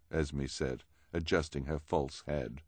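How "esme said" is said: ordinary delivery, nothing marked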